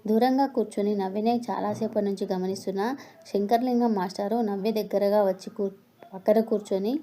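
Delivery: ordinary delivery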